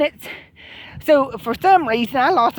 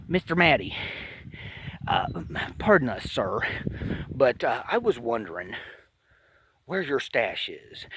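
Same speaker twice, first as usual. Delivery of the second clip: low, hushed, otherworldly polite alien; alien in-head voice; 11 semitones lower, 10 dB quieter than usual. Acted. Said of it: "in its head to my head".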